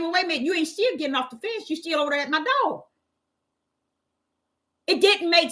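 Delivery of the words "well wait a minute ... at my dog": inner indignation. Retold or imitated. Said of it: "in my mind, I'm saying".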